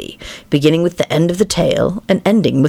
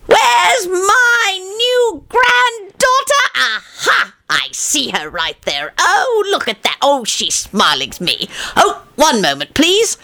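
much higher, hammed-up cheery old grandfather; grandfather's voice; slower than usual. Father imitating grandfather greeting the new granddaughter, high and hammy. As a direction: mimic the excitable grandpa greeting the baby. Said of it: "not to say".

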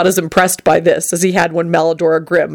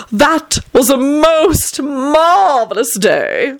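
high, slow, preening aristocratic voice; milk every boast like a vain lord; hammed-up self-adoring raconteur; vain vampire storyteller; 8 semitones higher, much slower than usual. A narrator's reading. Tony's boastful childhood tale.

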